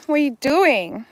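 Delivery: usual speaking voice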